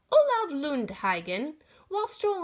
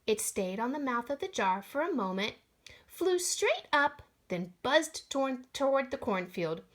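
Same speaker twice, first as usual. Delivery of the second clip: ordinary delivery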